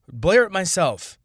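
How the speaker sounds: mock-shouted echo; loud repeat. Real, not acted. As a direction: belt it out like a rant punchline. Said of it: "I would blare it myself".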